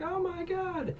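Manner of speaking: squeaky falsetto gasp of shock; a high squeal of fright; mock falsetto oh my god; high shriek. Cat lady shrieks at discovery.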